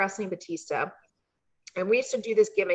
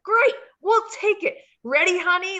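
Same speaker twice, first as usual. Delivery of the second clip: high, loud cheesy eager husband; hammed-up husband; 10 semitones higher, 5 dB louder than usual. Cliff to the clerk, hammed-up high eager husband. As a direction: overplay the happy husband grabbing the room. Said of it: "we're totally hamming it up".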